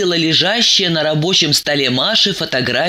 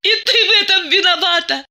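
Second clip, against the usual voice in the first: fast, high-pitched accusing cry; hysterical shout; faster than usual. A narrator's reading. Masha pounds the table blaming Diana, hysterical. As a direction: scream the blame out, high and quick. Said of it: "кричала она".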